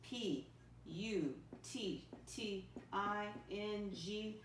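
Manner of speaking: very slow, letter by letter; spelling aloud. Shown as a demonstration. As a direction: spell it out with long gaps for pupils. Spelling a word for pupils.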